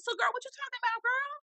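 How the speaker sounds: very high, fast sassy taunt. Retold or imitated. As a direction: tease her in a squeal. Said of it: "And I'm like".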